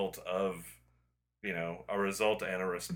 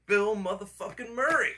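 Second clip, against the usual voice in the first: Bill Murray impression; 12 semitones higher, 3 dB louder than usual. Quoting Bill Murray's movie line.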